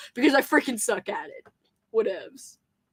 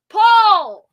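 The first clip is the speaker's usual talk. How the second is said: calling out; 9 semitones higher, 10 dB louder than usual. Real, not acted. Calling to Paul again.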